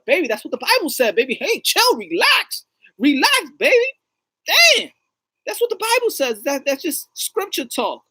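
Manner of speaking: high, loud comic pleading; panicked self-defence, acted. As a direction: plead with an offended girlfriend to calm down. Acted man defends himself to her.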